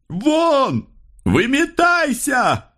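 high-pitched, stretched-out command; frenzied shout. A narrator's reading. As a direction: yell it like a crazed order. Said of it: "с криком, воплем, бешеным приказом". (бешеный вопль-приказ)

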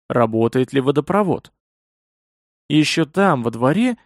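usual speaking voice

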